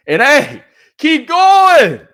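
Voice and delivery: loud, high rallying cry; shouted send-off; hyped shout. Real, not acted. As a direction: pump them up with a big shout.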